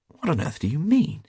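high, baffled outburst; astonished exclamation. A narrator's reading. Hughie baffled by talk of the beggar's riches, exclaiming. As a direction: exclaim it in disbelief. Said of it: "exclaimed Hughie".

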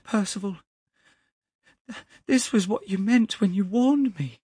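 very high, loud, shocked reproach; distraught accusation. A narrator's reading. Young man realises what the clerk's warning meant, accusing and distraught. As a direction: cry it out accusingly.

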